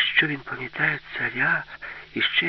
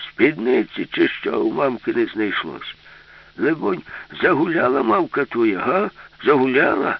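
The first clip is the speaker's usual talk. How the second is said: cranky scolding grandpa; 5 dB louder than usual. Acted. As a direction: berate a child as a scandalized grandpa.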